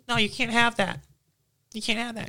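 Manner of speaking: high squeaky mock teacher voice; teacher impression. Student imitates teacher taking his MP3 player, high squeaky voice.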